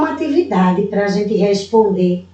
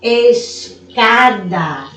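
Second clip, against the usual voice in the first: Escada drawn out slowly, stressing CA; stress on penultimate syllable; much slower than usual. Shown as a demonstration. Teacher demonstrates a paroxytone to pupils, slow Escada with stressed ca. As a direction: say Escada syllable by syllable, leaning on the middle ca. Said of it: "A sílaba mais forte é a".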